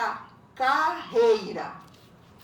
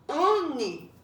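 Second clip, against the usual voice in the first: "Uni" in two drawn-out beats, U - ni, first one higher and louder; split into syllables; 5 semitones higher, 5 dB louder than usual. Shown as a demonstration. Teacher showing separated syllables, "Uni" split as U - ni. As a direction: break "Uni" into U, then ni. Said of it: "já tá separada a sílaba".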